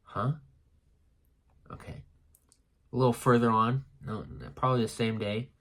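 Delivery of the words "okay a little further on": ordinary delivery, nothing marked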